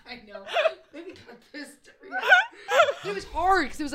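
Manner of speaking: high, loud laughing plea; laughing embarrassed. Real, not acted. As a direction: laugh it out, mortified. Embarrassed, laughing at own story.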